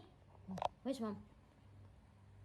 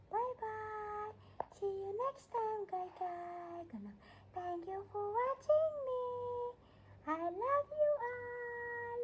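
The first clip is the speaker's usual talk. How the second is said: very high, slow squeaky puppy voice; pet signing off; 13 semitones higher, 4 dB louder, slower than usual. Pretend. The dog saying goodbye to viewers, high slow squeak. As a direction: drag out a tiny dog's goodbye.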